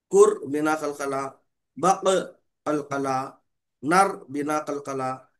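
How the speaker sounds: each syllable drawn out, कुर and नर held without echo, बक kept; slow recitation demo. Shown as a demonstration. Teacher slowly demonstrating letters and कलकला echo to students. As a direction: spell out कुर बिना कलकला slowly, like a teacher showing the class. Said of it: "इस तरह बोलते हुए पढ़िए".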